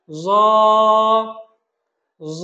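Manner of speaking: জ with a full rounded o sound; thick, heavy letter. Shown as a demonstration. Teacher asks students to repeat, জ thick and rounded. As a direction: say জ heavily and hold it. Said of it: "একটু মোটা করে উচ্চারণ করব".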